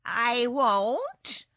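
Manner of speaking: loud, drawn-out, sulky refusal; surly kitten. A narrator's reading. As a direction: refuse as a sullen cat. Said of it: "in a surly voice".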